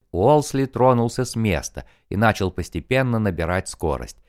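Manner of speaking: plain reading voice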